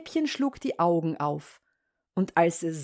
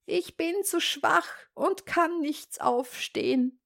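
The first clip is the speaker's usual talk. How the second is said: high-pitched, feeble sickbed voice; frail granny pleading weakness, high up; weak old grandmother; 8 semitones higher, 3 dB quieter than usual. Acted. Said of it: "rief die Großmutter".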